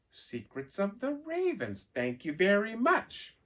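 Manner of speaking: sarcastic soldier. Acted. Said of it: "said the angry soldier".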